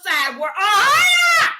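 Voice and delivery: shouted high at the peak; preaching shout. Acted. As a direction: cry it out to the congregation. Climax of preached rebuke.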